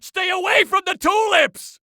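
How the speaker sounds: high-pitched alarmed shout; shrieked villain order; urgent warning. Acted. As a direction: yell it as a frantic warning. Warns SpongeBob.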